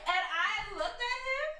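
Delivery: high, laughing through the words; crying with laughter. Real, not acted. Story collapses into laughter.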